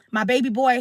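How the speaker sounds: ordinary delivery, nothing marked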